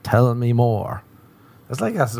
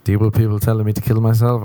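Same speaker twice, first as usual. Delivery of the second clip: usual speaking voice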